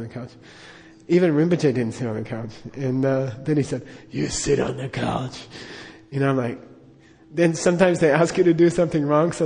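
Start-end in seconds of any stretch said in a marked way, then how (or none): 4.1-5.5 slow, damaged-voice lama giving orders; Darth Vader. Retold or imitated. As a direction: imitate a stern teacher with a crushed voice box. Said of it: "he talked like Darth Vader".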